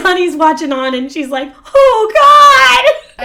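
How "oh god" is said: high, loud horrified gasp; loud high shocked cry; mock horror. Voicing horrified onlooker.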